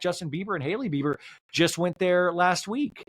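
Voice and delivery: normal talking voice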